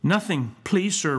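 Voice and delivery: a hint of a timid child; little boy. A narrator's reading. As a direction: plead meekly as a small pupil. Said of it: "replied the little boy".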